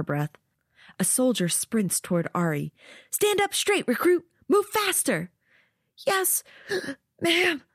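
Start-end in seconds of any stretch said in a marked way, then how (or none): 3.1-5.3 high barking drill sergeant. Acted. Drill soldier yelling at recruit. 6.1-7.6 gasping for breath. Acted. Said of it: "Ari gasps out the words".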